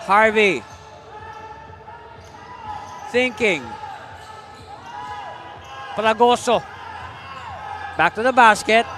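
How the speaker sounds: punchy basketball call in short bursts; live play-by-play. Real, not acted. Commentator following a scramble for the ball, urgent play-by-play. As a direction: call each move as it happens, pausing between plays.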